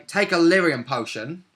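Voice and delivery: ordinary delivery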